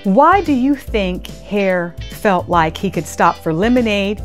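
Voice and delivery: ordinary delivery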